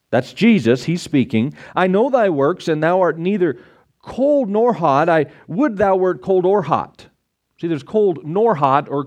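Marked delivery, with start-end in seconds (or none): 1.7-7.1 measured, solemn Bible reading; scripture reading. Acted. Pastor reads Revelation to the congregation, solemn reading. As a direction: read it like the King James text.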